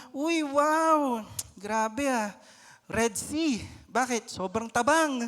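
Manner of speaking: high, loud exaggerated praise of bland food; mock delight. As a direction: gush over the dish playfully. Patient parent praises bland food. (masiglang papuri)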